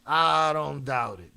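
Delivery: loud, slowly drawn-out exclamation; emphatic reaction. Real, not acted. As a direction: stretch the words out loudly. Reacting to the shocking news.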